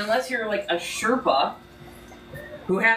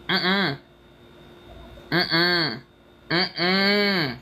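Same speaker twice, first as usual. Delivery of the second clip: firm disapproving mm-mm; much slower than usual. Real, not acted. Refusing, shaking head.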